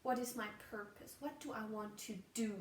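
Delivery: ordinary talk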